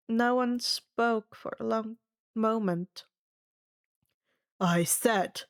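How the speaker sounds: ordinary delivery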